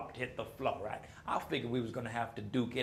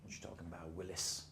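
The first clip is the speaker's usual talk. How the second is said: low, much softer, deadpan catchphrase; Willis catchphrase; 10 semitones lower, 14 dB quieter than usual. Retold or imitated. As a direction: drop low and quiet for the famous line. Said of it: "I was fixing to say something like".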